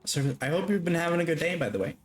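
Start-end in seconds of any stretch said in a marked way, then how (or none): none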